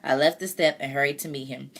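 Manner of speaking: ordinary delivery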